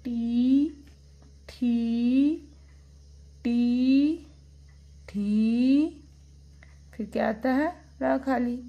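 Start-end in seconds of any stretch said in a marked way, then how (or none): 0.0-6.1 टी and थी loud, each with a long ee; reading-drill demonstration. Shown as a demonstration. Teacher reads back to children, long loud syllables. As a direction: call out stretched टी, थी with gaps between. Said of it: "फिर से read करते हैं".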